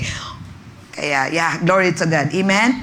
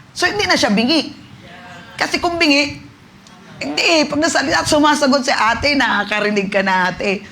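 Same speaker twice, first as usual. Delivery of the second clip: high, loud, thrilled retelling; excited testimony; 9 semitones higher, 4 dB louder than usual. Real, not acted. Pastor recounting the healing to church, thrilled and loud. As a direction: let the joy burst out.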